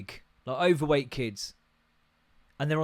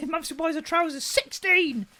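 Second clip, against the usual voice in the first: mock shock; 13 semitones higher than usual. Real, not acted. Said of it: "like, sixteen".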